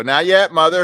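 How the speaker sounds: high, loud retold objection; imitating a son cutting mom off; son's protest. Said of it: "I go".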